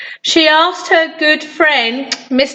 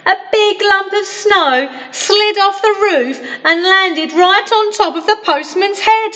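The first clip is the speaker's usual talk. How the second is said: plain reading voice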